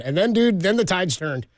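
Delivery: normal talking voice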